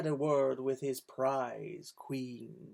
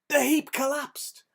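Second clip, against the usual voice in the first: high, loud dramatic narration; dramatic collapse; 11 semitones higher, 8 dB louder than usual. A narrator's reading. Storyteller at the climax, high and loud. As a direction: announce the collapse big.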